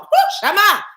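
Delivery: high, loud whoop; sudden shouted burst; spirit exclamation. Real, not acted. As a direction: cry out as if jolted by the spirit. Sudden spirit exclamation mid talk.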